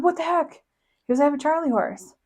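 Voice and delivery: high, pained exclamation; husband caught off guard by a cramp, pained and high; retold yelp of pain. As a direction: squeak it out like a sudden cramp. Husband dropped by leg cramp.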